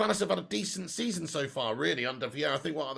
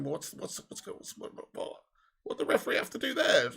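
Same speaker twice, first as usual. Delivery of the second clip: whispered confusion; 5 dB quieter than usual. Real, not acted. Confused at referee's odd move.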